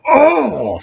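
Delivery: high sudden yelp of pain. Retold or imitated. Guard retells getting kicked in the groin, mock pained yelp.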